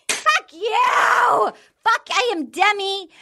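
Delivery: hammed-up high shout at him; mock furious yelling. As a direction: scream it like a jilted woman. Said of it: "fucking enraged".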